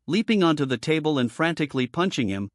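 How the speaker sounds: usual speaking voice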